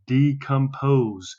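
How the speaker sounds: decompose drawn out slowly, syllable by syllable; slow model word. Shown as a demonstration. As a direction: stretch out decompose for the class to repeat. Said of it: "You say that word".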